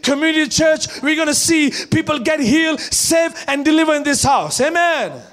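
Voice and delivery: loud, high, driving sermon; full-force preaching. Acted. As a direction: preach it to the whole church.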